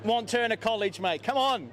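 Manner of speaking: playful taunt at a rival fan; mock scolding. Real, not acted. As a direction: rib the rival fan like a cheeky coach.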